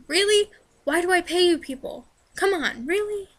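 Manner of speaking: high-pitched, incredulous "really"; retold exasperation. Stranded customer to roadside service, retold exasperation. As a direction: act out mock indignation, pitch jumping up.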